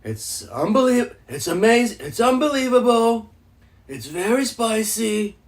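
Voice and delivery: light Trump superlatives; a hint of a boastful Trump. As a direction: brag about the spice like Trump. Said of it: "just speak normally".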